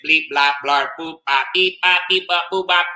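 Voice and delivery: staccato robot bleeps and bloops. Acted. Octopus reads favorite book in robot language, robotic. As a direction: chant the syllables like a toy robot. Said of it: "in robot language".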